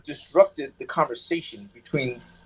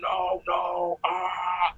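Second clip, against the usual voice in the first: fading male entity voice; 3 semitones higher, 6 dB quieter, much slower than usual. Retold or imitated. Caller imitates the voice heard during the exorcism, fading wail. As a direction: imitate a wailing spirit voice fading away. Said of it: "and it was fading out".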